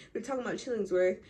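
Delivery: normal talking voice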